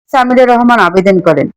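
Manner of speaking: ordinary delivery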